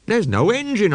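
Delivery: put-on young engine surprised at the trucks. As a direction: blurt it out amazed. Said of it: "I was surprised".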